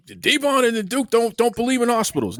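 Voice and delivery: high, mocking headline voice. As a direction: pitch it way up, teasing the rumor mill. Mocking imagined gossip headline.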